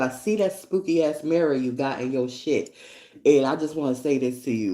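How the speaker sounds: usual speaking voice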